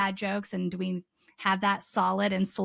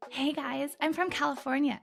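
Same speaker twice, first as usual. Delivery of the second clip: hammed-up Valley-girl Californian accent, higher; mock Californian accent; 6 semitones higher than usual. Co-host opening in a mock Californian accent. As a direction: put on a playful Californian accent.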